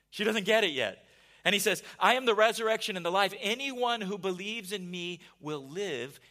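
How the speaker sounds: loud, rising preacher's build; preaching at full force. Acted. Preacher thundering Jesus' answer to Martha, loud and urgent. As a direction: drive Jesus' words home to the crowd.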